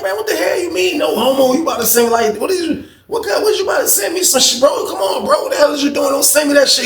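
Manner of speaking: high, incredulous outburst; outraged. Retold or imitated. Dude outraged at no homo.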